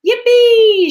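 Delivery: very high, very loud whoop; joyful shout. A narrator's reading. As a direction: whoop like on a rollercoaster. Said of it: "shouted Grandpa Joe".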